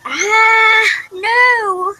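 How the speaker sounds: high, loud wail of "no"; crying out. Real, not acted. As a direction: wail it out high, drawing it long. Sudden cry breaking off the story.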